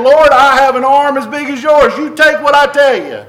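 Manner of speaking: preacher mocking a proud sinner, loud defiant boast; defiant boast to God. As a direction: throw down the gauntlet at the Lord. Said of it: "They're saying".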